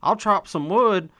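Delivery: high, loud hammed-up Southern cowboy accent; mock Southern accent. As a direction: parody a simplistic Southern cowboy's howdy. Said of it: "I hate Southern accents".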